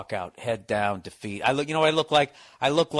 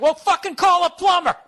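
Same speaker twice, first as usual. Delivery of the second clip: high, loud mock-angry dad yell; dad getting mad; 9 dB louder than usual. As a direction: explode in exasperation, imitating a fed-up father. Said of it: "he started getting mad".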